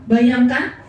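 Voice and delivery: usual speaking voice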